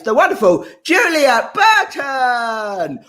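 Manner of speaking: high, drawn-out stage introduction; announcer's shout. Real, not acted. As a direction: call the act on, stretching out the name. Host introduces act to stage.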